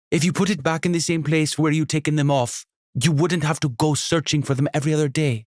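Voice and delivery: high, weary, scolding mom; exasperated mother. Acted. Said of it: "with the exhaustion of a mother".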